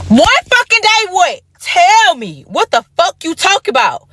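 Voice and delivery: high, pressing interruption; impatient demand. Retold or imitated. Cutting off her stalling man, high and impatient. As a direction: cut him off, impatient. She cuts off his stalling.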